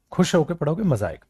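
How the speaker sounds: normal talking voice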